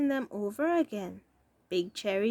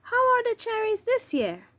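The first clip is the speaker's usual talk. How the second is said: chatty lady customer; 11 semitones higher, 3 dB louder than usual. Acted.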